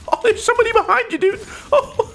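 very high, fast alarm; panicked squeal. Real, not acted. Gamer spotting someone behind, high panicked squeal. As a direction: squeak out a hurried warning.

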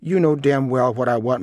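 irritated, overbearing reply; angry. A narrator's reading. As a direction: snap impatiently at the waiter. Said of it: "my father said angrily".

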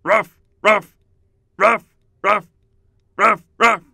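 high, loud woof woofs; dog barking. Shown as a demonstration. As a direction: bark like an eager dog. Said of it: "begin barking like a dog".

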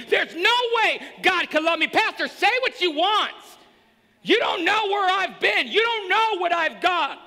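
loud, high desperate protest; despairing sinner. Retold or imitated. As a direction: cry out that God can't love you. Voicing despairing sinner to pastor.

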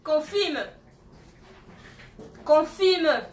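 emphatic auctioneer's push; insistent demand. Real, not acted. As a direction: press the bidder to confirm, loudly. Pressing a bidder to confirm.

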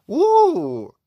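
long, very high, loud "woo"; excited whoop. Real, not acted. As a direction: let out a big delighted whoop. Thrilled at a card pull.

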